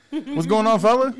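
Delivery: high, loud buddy greeting; hearty greeting. Acted. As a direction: greet him like an old pal. Host greets guest loudly.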